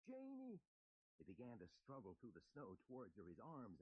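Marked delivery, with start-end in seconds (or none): 0.1-0.6 high loud call; crying out. Acted. Lumley calling to his wife in the snow. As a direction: cry out her name in longing. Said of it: "Lumley cried".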